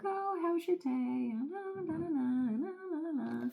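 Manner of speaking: high, soft singsong chit-chat; airy high-pitched blah-blah dialogue; mock small talk. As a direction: parody idle chatter in a high, light voice. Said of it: "a little back and forth dialogue".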